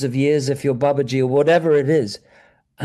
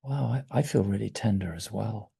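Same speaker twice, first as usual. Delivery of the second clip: soft admission; 4 semitones lower, 8 dB quieter than usual. Retold or imitated. Another member shares the feeling.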